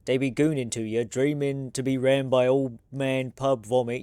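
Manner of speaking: usual speaking voice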